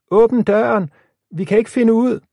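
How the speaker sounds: high, loud desperate shouting. A narrator's reading. Lisa, trapped in smoke, shouts to Johnny to open up. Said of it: "råbte Lisa".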